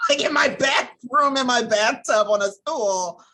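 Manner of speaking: loud, high, laughing emphasis; laughing disbelief. Real, not acted. Mother confessing her absurd hiding place, laughing in disbelief. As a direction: belt out each detail on the edge of laughter.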